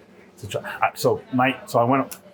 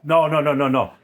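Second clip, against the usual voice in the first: rapid-fire, higher-pitched denial; emphatic protest; 4 semitones higher than usual. Real, not acted. Chef hurriedly denies being better than his dad, emphatic protest. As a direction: cut the question off with urgent protest.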